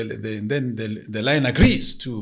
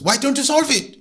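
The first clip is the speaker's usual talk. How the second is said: impatient spirit; 14 semitones higher, 8 dB louder than usual. Acted. Spirit questions the woman.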